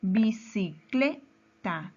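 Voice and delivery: "bicicleta" in slow separate syllables, CLE stressed; careful teacher's breakdown of "bicicleta", leaning on CLE. Shown as a demonstration. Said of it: "mayor fuerza de voz".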